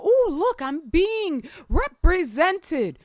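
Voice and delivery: high, sing-song fake excitement; mock naive delight. Mocking how sisters react to propaganda, gushing. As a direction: play the gullible viewer, gushing. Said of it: "And so that we go".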